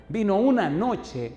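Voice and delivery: high-pitched emphasis on "una noche"; predicación enfática. Acted. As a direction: punch "una noche" high each time. Preacher stresses one night. (énfasis agudo en "una noche")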